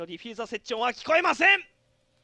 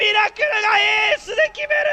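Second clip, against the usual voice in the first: excited shout; 7 semitones higher, 9 dB louder, slower than usual. Real, not acted. Ace called by caster.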